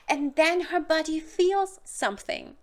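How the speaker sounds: ordinary talk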